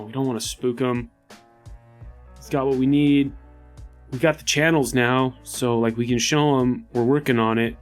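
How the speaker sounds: normal talking voice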